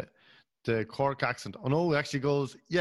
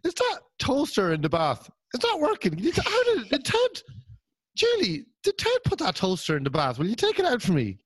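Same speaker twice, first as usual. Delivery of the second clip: much higher, sing-song fake innocence; mock-innocent wife; 7 semitones higher than usual. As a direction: lure Julie to the toaster sweetly. Mock-innocent murder plot questions.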